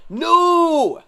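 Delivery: sudden high, loud shout of disbelief; shocked no. Real, not acted. Streamer seeing his team's draft pick, a loud shocked cry. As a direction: blurt it out in horror.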